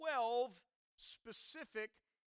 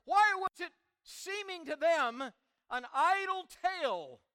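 louder, emphatic pulpit questioning; preaching; 3 semitones higher, 5 dB louder than usual. Real, not acted. Preacher wondering at the disciples' disbelief, forceful preaching. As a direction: press the question with rising force.

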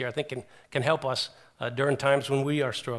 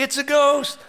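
high, loud cry of alarm; panicked, high-pitched exclamation; scared cry; 12 semitones higher, 8 dB louder, slower than usual. Retold or imitated. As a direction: imitate terrified men shouting out. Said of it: "and they are scared".

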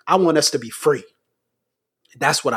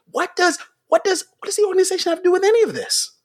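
high mock voice; 12 semitones higher, much faster than usual.

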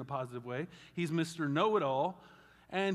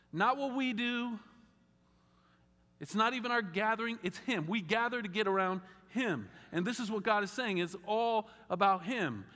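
preaching; 6 semitones higher than usual. Real, not acted. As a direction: lift it up and press each phrase. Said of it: "preaching climax".

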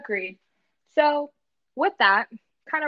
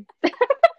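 high laugh; 14 semitones higher, 7 dB louder than usual. Real, not acted. Laughing at interruptions.

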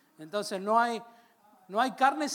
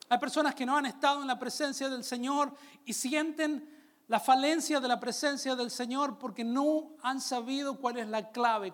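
ordinary delivery

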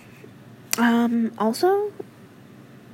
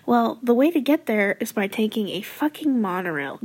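normal talking voice

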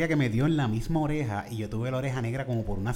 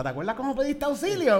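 teasing mom; 9 semitones higher than usual. Retold or imitated.

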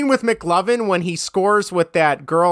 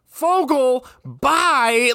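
imitating a fed-up teacher, higher pitch; weary teacher dismissal; 6 semitones higher, much slower than usual. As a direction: send off the usual troublemaker, mildly exasperated. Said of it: "you can just tell this has repeatedly happened".

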